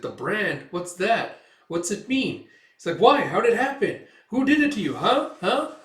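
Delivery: rising, high-pitched barrage of questions; acted nosy elf, insistent; eager pestering.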